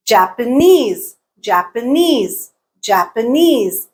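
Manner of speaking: Japanese slowed, NESE long and strong; last-syllable stress. Shown as a demonstration. Teacher showing learners word stress, Japanese stressed on NESE. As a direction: save the weight in Japanese for the final NESE. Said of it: "Knees is the stressed syllable".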